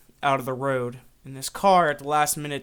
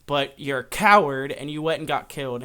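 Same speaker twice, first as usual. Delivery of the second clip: louder, raised-pitch acted tirade; retold outrage, punching the key words; angry rant; 3 semitones higher, 6 dB louder than usual. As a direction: hurl accusations at a rival. Rival storms wake, rants.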